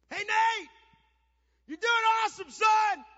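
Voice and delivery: very high, full-volume cheer; screaming out. Retold or imitated. Dad cheering his benched son from the stands, screaming. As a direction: holler encouragement from the stands. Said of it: "I'd scream out".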